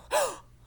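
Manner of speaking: loud high surprised gasp; demo gasp. Shown as a demonstration. Teacher showing the class a gasp. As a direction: show a big sharp intake of breath. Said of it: "take a big, deep breath and go".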